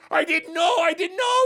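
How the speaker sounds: very high, frantic pleading; panicked apology. Acted. Beggar pleads with the real king, panicked.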